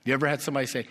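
ordinary delivery

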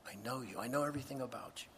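soft, low, intimate repetition of a name; hushed and reassuring, as if drawing someone close; gently voiced, knowing reassurance; tender calling. God tenderly calls Moses by name.

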